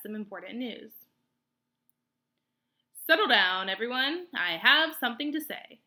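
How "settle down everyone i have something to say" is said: owl hooting to crowd. A narrator's reading. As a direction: raise your voice over the meadow. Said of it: "Swooper the owl hooted to the crowd".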